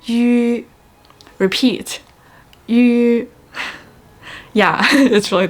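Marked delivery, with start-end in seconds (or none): 0.0-0.7 "yu" drawn out as E and U combined; vowel demo. Shown as a demonstration. Teacher modelling the sixth vowel, "yu" as blended E-U, held. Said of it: "has the sound of yu". 2.7-3.3 "yu" drawn out as E and U combined; vowel demo. Shown as a demonstration. Teacher modelling the sixth vowel, "yu" as blended E-U, held. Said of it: "has the sound of yu".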